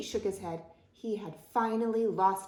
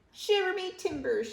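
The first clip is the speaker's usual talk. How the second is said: high-pitched shocked pirate cry; pirate shout; 11 semitones higher than usual. A narrator's reading. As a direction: shout it as an exasperated captain. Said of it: "shouted Pete".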